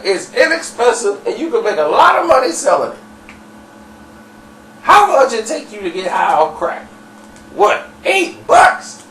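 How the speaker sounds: high, insistent hard sell; impassioned pitch. Retold or imitated. Dirty selling crack as a business, impassioned. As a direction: voice climbing to a squeal on the price. Acted sales rant about crack.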